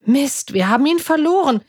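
high-pitched frustrated exclamation; calling out. A narrator's reading. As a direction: cry out at a setback. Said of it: "rief Emily".

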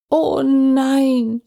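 wailing grandma's groan; groaning grandmother. A narrator's reading. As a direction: groan in dismay like a granny. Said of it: "stöhnte Oma Christine".

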